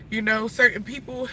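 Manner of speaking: ordinary talk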